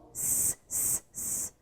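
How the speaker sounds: "s" as a bare, soft voiceless hiss; sound of S. Shown as a demonstration. Teacher introducing the next sound, isolated S hiss. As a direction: repeat "s" as isolated hissing consonants, no vowel. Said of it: "som de S".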